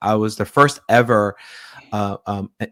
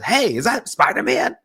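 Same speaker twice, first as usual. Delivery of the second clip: mock-excited caller, voice pitched way up; retold eager greeting; 11 semitones higher than usual. Friend teasing him on the phone mid-movie, eager high-pitched imitation.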